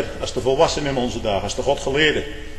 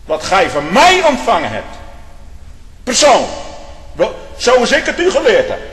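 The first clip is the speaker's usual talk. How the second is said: loud, high fire-and-brimstone preaching; preaching at full force; 7 semitones higher, 6 dB louder than usual. Acted. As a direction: thunder it from the pulpit. Preacher attacks self-righteousness.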